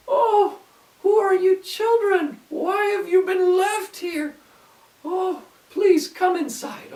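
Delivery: put-on high, sweet little old lady voice. A narrator's reading. Kind old lady invites lost children inside, high and sweet. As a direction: coo like a kind granny luring children in. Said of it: "she said".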